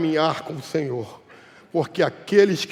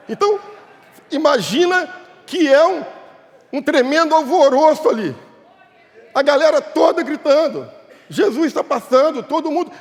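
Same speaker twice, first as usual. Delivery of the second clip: high-pitched, urgent preacher storytelling; impassioned preaching; 10 semitones higher, 5 dB louder than usual. Real, not acted. Preacher picturing a shouting crowd round the blind man, impassioned preaching. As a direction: paint the uproar with raised, driving voice.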